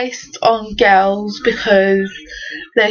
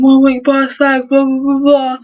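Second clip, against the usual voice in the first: retold shouting; 3 semitones higher than usual. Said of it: "they started shouting at us".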